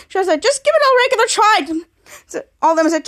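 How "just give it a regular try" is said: high, impatient plea; acted exasperation. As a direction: snap it as one exasperated character. Retold exasperated single character.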